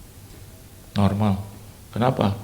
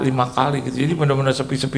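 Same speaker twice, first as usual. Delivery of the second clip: ordinary talk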